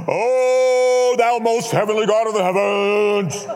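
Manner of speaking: high, loud, drawn-out church intoning; mock pious prayer. Churchman praying before communion, mock grand intoning. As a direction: intone it grandly, hammed-up holy voice. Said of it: "He doesn't talk like that".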